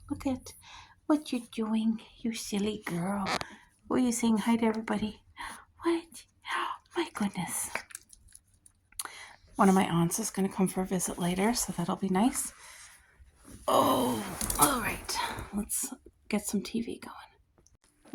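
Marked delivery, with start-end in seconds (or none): 0.1-7.7 soft whisper to a pet. Real, not acted. Whispering fondly to her silly girl dog. 13.7-18.1 hushed unvoiced whisper, slow; soft whisper. Real, not acted. Whispering to the pet while settling in.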